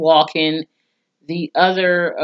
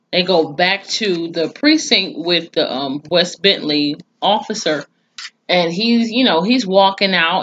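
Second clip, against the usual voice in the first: ordinary talk, nothing marked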